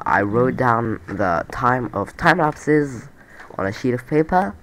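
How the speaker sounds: ordinary talk, nothing marked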